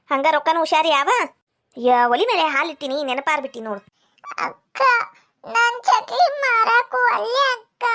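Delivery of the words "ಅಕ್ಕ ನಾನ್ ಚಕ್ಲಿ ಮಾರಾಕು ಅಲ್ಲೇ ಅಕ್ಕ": put-on much higher, slow small boy; child's voice. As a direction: speak as the little brother. (ಚಿಕ್ಕ ಹುಡುಗನ ಧ್ವನಿ)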